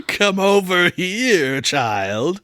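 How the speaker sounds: drawn-out high call, dropping on the last word; long, stretched summons; crying out. A narrator's reading. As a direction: call slowly to a child some way off. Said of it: "crying out".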